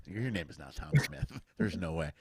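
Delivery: ordinary talk, nothing marked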